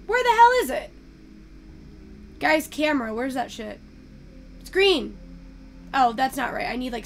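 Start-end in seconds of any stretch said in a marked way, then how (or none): 0.0-0.9 sudden high, loud where-is-it; frustrated outburst, much higher and louder; exasperated. Real, not acted. Streamer hunting for the camera menu, exasperated. Can't find camera button.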